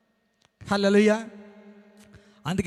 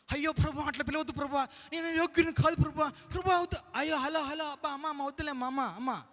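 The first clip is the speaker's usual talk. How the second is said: hushed, higher, melodramatic refusal; mock over-humble whine; 3 semitones higher, 9 dB quieter than usual. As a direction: overdo the humility, near a whimper. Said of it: "over action".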